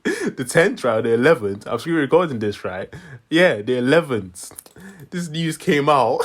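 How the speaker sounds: real amused laughter between phrases; laughing. Real, not acted. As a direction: struggle to get the news out through laughs. Cracking up over [name] news.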